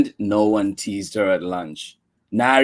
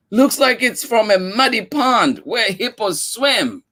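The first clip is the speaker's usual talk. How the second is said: exclaimed; 10 semitones higher than usual. A narrator's reading. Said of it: "Another girl exclaimed".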